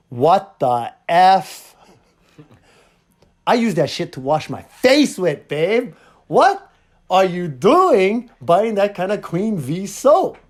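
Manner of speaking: loud, drawn-out comic indignation; mock outrage. Husband confronting his wife about her soap, mock outraged. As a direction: play up the appalled husband, punching key words loudly.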